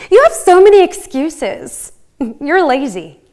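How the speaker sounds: accusing critic. Acted. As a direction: throw it out as a scolding critic. Monologue voices an accusing critic.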